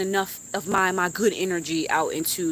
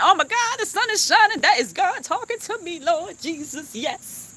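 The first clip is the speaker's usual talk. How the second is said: high, rising thrilled cries; real excited awe; 10 semitones higher than usual. Real, not acted. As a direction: overcome with joy at a sign. Sun comes out, sees God.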